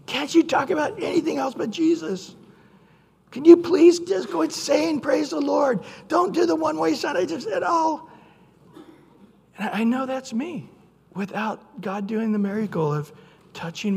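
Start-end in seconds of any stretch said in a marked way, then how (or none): 0.0-8.1 mock annoyed classmates. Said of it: "It's like".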